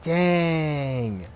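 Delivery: drawn-out impressed dang; wow-struck, loud and high. Real, not acted. Impressed reaction to armor.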